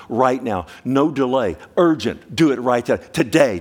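loud, pressing call to act now; urgent preaching. Real, not acted. Preacher urging the congregation to act today, loud and pressing. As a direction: drive home the urgency.

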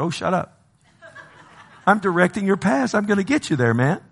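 lightly voiced God, brushing off the complaint; playful God, high. God voiced, joking reply.

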